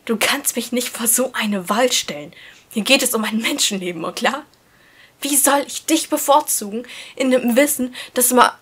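a hurt, heated protest; agitated protest. A narrator's reading. Heated argument with friend.

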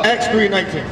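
usual speaking voice

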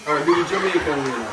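loud, fast and laughing. Real, not acted. Excited laughing request.

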